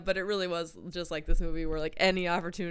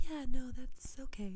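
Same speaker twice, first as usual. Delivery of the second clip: breathy whispered meek agreement; mock whisper; 7 semitones higher than usual. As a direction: whisper it like a pushover. Pundit meekly agreeing with guests.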